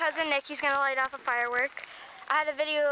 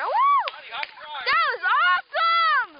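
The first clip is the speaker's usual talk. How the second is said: high whoop, then loud drawn-out rave; excited cheer; 12 semitones higher, 6 dB louder, much slower than usual. Real, not acted. Kid cheering a sparkler in a can, thrilled shout. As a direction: whoop and stretch out the praise.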